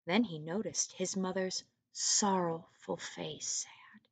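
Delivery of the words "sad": plain reading voice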